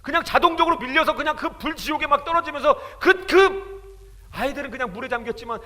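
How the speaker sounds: loud, high, impassioned sermon; fiery preaching. Acted. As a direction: preach it with full fervor. Preaching at full force.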